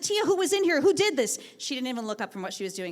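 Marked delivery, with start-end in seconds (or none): 0.0-1.4 higher, louder eager questions; excited. Retold or imitated. Teacher asking her aide who did it, excited. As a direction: press for an answer, thrilled. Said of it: "I was over the moon".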